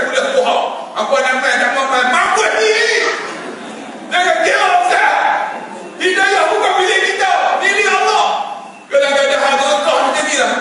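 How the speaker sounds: high, impassioned sermon voice; forceful preaching. Real, not acted. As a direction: drive the moral home from the pulpit.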